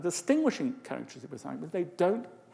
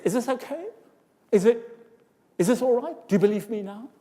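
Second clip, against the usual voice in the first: higher rising question endings; demonstrating the uptalk of a liar; 6 semitones higher, 5 dB louder than usual. Shown as a demonstration. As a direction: end each line up, seeking approval. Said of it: "pitch raise says".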